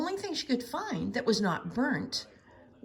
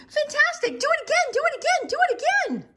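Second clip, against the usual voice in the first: high, loud cheer; excited shouting; 7 dB louder, faster than usual. A narrator's reading. Princess urging the dragon to fly again, shouting. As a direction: egg him on, thrilled. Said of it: "Elizabeth shouted".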